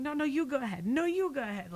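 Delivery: high, overly courteous Okie driver; a hint of Okie accent, politely insisting. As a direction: wave the other car through, sweetly. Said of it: "people like".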